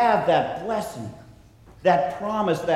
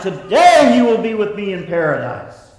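louder, ringing proclamation of triumph; triumphant; 4 semitones higher, 7 dB louder than usual. Shown as a demonstration. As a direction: proclaim it like a victory cry, TODAY up front. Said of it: "What words of triumph".

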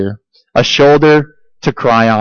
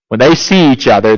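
ordinary talk, nothing marked

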